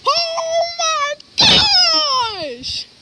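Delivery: long, high, drawn-out scream; real scream. Real, not acted. Caught outside in the worst winds, screaming in real fright. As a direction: let a real scream stretch out in fear. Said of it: "I'm literally screaming right there!".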